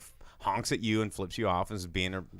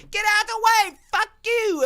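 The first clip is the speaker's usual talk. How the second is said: very high, loud screeching rant; mock angry yell; 10 dB louder than usual. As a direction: scream it like a furious neighbor at a driver. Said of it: "this lady's like".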